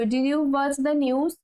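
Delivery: slow, clear teacher's model; slow model sentence. Shown as a demonstration. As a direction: say it very slowly and clearly for learners. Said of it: "teacher models slow English". (ধীরে স্পষ্ট করে বলা)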